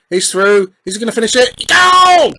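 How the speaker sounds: building high and loud into a shouted "Goal!"; mounting excitement bursting into a high shout; excited goal call. Real, not acted. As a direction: commentate the chance, rising to a big cheer. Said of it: "why I'm sounding so excited".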